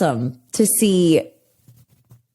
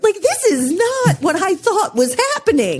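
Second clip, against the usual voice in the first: high-pitched, exasperated disbelief at oneself; mock bewilderment; 12 semitones higher than usual. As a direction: replay your baffled realization, pitched way up. Retelling own shocked reaction.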